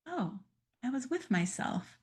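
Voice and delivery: quietly notice you're with yourself; soft realization. Shown as a demonstration. Demo of realizing self-presence.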